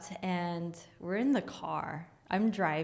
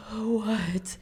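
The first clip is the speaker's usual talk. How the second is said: gasp, then a drawn-out "what"; imitated startled confusion; dazed shock; 3 semitones higher, 5 dB louder than usual. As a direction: breathe in sharply and ask bewildered. Dazed friend's reply, retold.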